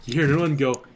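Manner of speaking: ordinary talk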